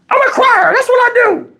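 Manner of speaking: very high-pitched girlfriend imitation; imitating tearful girlfriend. Girlfriend explaining why she cries in arguments, very high mock voice. Voices Tony answering, mid-cry.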